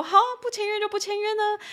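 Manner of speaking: high, drawn-up defiant quote; mock swagger. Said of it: "可以这么煞气的就说". (煞气地模仿呛声)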